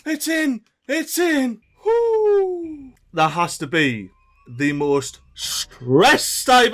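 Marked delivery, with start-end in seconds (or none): 0.1-0.1 very high, frantic shouting; desperate urging. Real, not acted. Time running out, urging beaver on. 0.1-2.9 high, relieved little cheer; excited relief. Real, not acted. Relief as levee gets finished.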